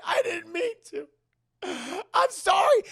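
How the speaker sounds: high mock-crying wail; tearful pleading. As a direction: beg forgiveness in a hammed-up sob. Acted father pleading in tears.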